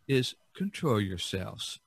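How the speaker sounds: usual speaking voice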